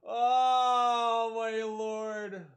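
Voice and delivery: slow, drawn-out "Oh my Lord"; exasperated disbelief. Real, not acted. Streamer reading wild DMs, slow, floored disbelief. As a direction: stretch each word out, floored.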